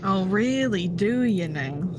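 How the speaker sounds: ordinary delivery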